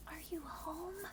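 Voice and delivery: high, soft, slow neighbor whisper; scared neighbor whispering over the fence; frightened whisper. Acted. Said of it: "she whispers".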